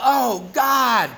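sudden high cry of panic; a man under a bear crying out for God; retold yell. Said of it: "And he yelled out".

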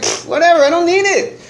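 high, scoffing brush-off; mimicking his past self brushing off losing everything, high scoff; dismissive shrug. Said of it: "it's like".

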